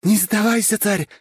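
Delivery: very high, loud screaming cheer; frenzied yelling. A narrator's reading. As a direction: yell yourself wild for your fighter. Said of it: "не своим голосом орала".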